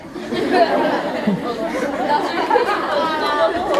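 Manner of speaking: big laugh. Real, not acted. Laughs at his own joke.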